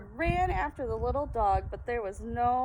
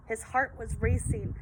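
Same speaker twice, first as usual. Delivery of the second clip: ordinary delivery, nothing marked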